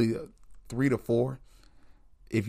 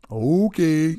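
mock okay; 6 semitones higher, 6 dB louder than usual. Friend conceding.

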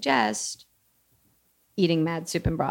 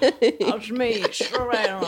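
put-on Georgia accent; 3 semitones higher, 3 dB louder, slower than usual. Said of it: "like, old antebellum Georgia".

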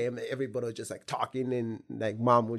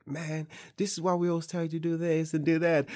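higher, louder told-you-so scolding; scolding family; 7 semitones higher, 5 dB louder than usual. Retold or imitated. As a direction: nag him like a fed-up relative. Family scolding him for failing.

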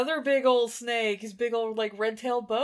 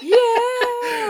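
loud, high, laughing cheer; drawn-out gleeful whoop; excited cheer; 13 semitones higher, 9 dB louder than usual. Real, not acted. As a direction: burst out in delight. Co-host delights in favorite scene.

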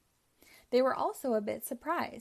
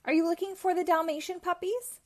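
higher calling voice as the colonel; 7 semitones higher than usual. Acted. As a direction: call across to the dogs. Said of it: "he called".

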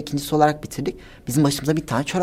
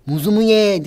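loud, higher jeering call; imitated yelling; 5 semitones higher, 8 dB louder than usual. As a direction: shout it like they yelled after you. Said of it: "diye bağırıyorlar".

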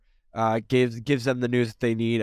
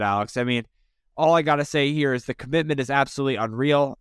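ordinary delivery

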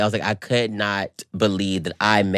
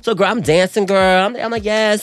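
excited; 9 semitones higher, 4 dB louder than usual. Retold or imitated. Excited retelling of dancing.

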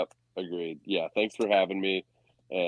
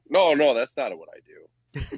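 mock Brock Purdy; 7 semitones higher, 4 dB louder than usual. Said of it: "And it's like".

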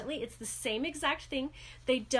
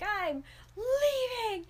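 high, slow whining sob; whiny dramatic teen; 10 semitones higher, much slower than usual. Retold or imitated. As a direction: wail it like a sulking teenager. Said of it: "he's like".

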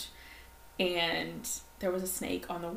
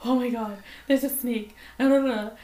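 panicked; 4 semitones higher, 6 dB louder than usual. Retold or imitated. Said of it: "And I was like".

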